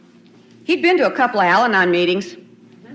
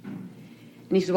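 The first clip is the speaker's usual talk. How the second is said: ordinary talk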